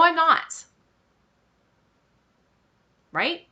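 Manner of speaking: ordinary talk, nothing marked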